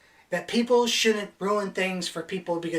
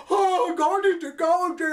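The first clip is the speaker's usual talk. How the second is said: much higher, louder awestruck stoner voice; stoned moviegoer; 12 semitones higher, 5 dB louder than usual. Retold or imitated. Stoned moviegoer gawking at the screen, awestruck. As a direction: gasp it out like a blown-away stoner.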